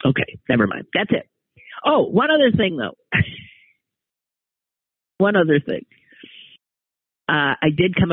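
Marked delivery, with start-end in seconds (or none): none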